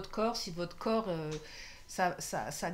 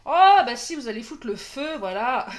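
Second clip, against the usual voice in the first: excited; 2 semitones higher, 12 dB louder than usual. Real, not acted. Finds a word in the letters.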